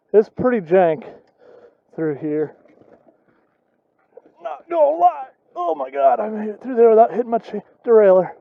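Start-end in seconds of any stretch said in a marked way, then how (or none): 4.4-8.3 relieved laughter. Real, not acted. Made it through the section.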